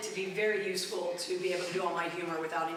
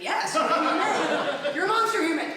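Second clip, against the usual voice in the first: higher, louder, slow, laughing through it; laughing mock outburst; 8 semitones higher, 7 dB louder than usual. Comic answering her online bully with a laughing yell. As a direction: let the insult swell into a laughing yell. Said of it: "I'm like".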